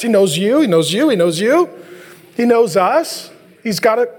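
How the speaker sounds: ordinary delivery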